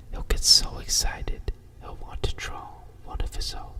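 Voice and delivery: plain reading voice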